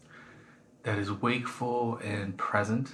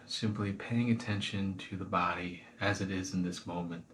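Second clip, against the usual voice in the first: guided meditation. Acted. Teacher guiding listeners through a body scan, calm meditation voice. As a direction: ease them gently into the body scan.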